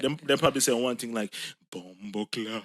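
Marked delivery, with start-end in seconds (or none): 1.7-2.6 imitating a film-style Jamaican accent; mock the go-to Jamaican accent; stock Jamaican accent. Co-host mocking entertainment's Jamaicans, stock Jamaican accent. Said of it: "everybody Jamaican accent in entertainment sound like".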